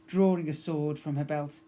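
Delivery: plain reading voice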